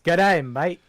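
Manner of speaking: high, loud mock Australian greeting; Aussie impression. To his co-host, a hammed-up Aussie accent. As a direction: ham up an Aussie g'day. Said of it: "our Aussie impressions".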